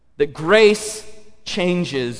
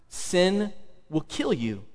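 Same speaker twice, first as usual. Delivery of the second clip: quiet grave warning; 8 dB quieter, slower than usual. Real, not acted. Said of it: "how to say that more emphatically".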